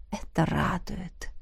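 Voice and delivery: soft, slow purr. A narrator's reading. Dragon pleased she finds him healthy, purring. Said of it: "мурлыкнул дракон".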